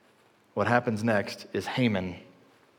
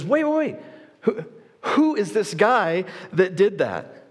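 urgent demand; 6 semitones higher than usual. Retold or imitated. King demands who saved him.

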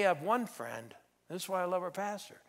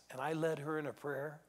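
ordinary talk

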